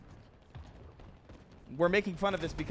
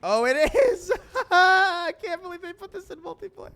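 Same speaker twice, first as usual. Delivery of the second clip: high-pitched laughing squeal; excited squeal; 11 dB louder than usual. Real, not acted. Excited reaction to funny game item.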